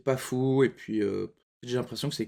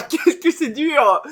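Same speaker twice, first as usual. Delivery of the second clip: slow, laughing, strained; laughing strained cry; 11 semitones higher, 9 dB louder, much slower than usual. Real, not acted. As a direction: drag it out through laughter. Holding in a burp, laughing.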